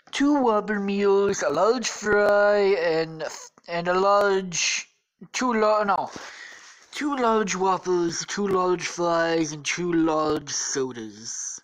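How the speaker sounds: loud, slow, stretched-out order; hammy presidential impression, drawing out each item; ordering as Trump. Trump orders at Burger King, slow hammy impression. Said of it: "they're ordering".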